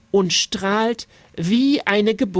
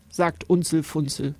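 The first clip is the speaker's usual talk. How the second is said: plain reading voice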